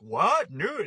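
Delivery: loud, high shocked "What? Nude?"; shocked girlfriend. Retold or imitated. Girlfriend hearing about the nude role, loud and shocked. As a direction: react in disbelief to the news. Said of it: "she's like".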